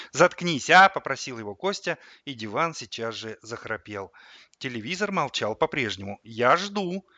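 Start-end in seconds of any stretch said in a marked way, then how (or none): 0.1-0.9 irritated plea. A narrator's reading. Boy silences the rambling sofa. 6.2-7.1 impatient. A narrator's reading. Boy loudly prompts silent TV.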